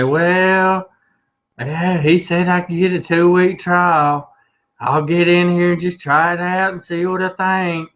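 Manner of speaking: high, slow, whiny country drawl. Retold or imitated. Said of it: "I love your redneck whiny voice".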